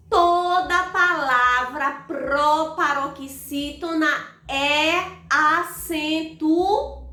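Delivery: slow, deliberate, word by word; emphatic rule. Shown as a demonstration. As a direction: spell out the rule, stretching "acentuada". Teacher states the key rule. (regra dita pausadamente, com ênfase)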